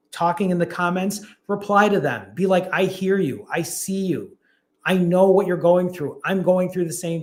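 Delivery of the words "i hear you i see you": usual speaking voice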